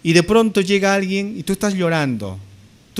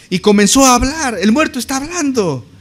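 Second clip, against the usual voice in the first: high, loud, astonished proclamation; amazed exclamation; 8 semitones higher, 6 dB louder than usual. Acted. Preacher telling how the dead son began to speak, amazed. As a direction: cry it out as a stunning miracle.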